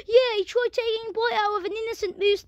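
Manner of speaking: high-pitched moose character voice; innocent moose. Pretend. Moose complaining about being bitten, high protesting voice. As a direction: put on a high, indignant moose.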